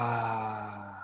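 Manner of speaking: slow, soft relaxing exhale sigh; long audible sigh. Shown as a demonstration. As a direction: let it all out on a belly sigh. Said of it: "exhale through my mouth and make a sound, make a sigh".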